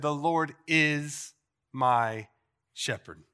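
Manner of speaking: preacher's refrain, slow and deliberate; slow, weighty refrain. Shown as a demonstration. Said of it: "He said".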